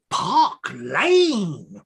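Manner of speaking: drawn-out, wailing amazement; awestruck exclamation. Acted. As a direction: gasp the name in swooping awe. Clerk awed by grand address.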